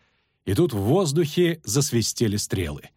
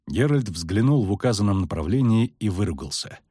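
plain reading voice

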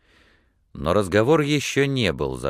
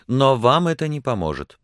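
higher, louder hurried retort; hasty objection; 9 semitones higher, 5 dB louder than usual. A narrator's reading. Woman hastily corrects her pleased suitor, insistent. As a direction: jump in to cut off his hopes. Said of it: "поспешно продолжала она".

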